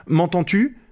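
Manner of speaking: high-pitched questioning call. A narrator's reading. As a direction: call up to check he hears. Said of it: "criait-il sans m'écouter le moindre du monde". (appel aigu, interrogatif)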